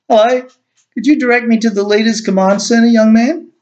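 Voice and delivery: a cheerful stranger asking directions; Rex greeting the guard. A narrator's reading. Rex to the gate guard, bright brisk greeting.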